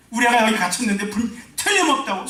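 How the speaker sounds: high, loud desperate father; a dad yelling to the firemen that his kid is in there; father shouting, high and loud. Retold or imitated. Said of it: "소리 지르면서".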